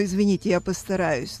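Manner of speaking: ordinary talk, nothing marked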